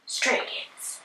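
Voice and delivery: usual speaking voice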